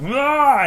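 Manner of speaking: high, loud mock war cry; a sudden enraged shout, hammed up; enraged yell. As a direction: let out a furious cry. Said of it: "Grey Worm is like".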